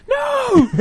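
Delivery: high-pitched shouted no; high yell. Pretend. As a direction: scream it out at the dog. Voicing someone at the bridge.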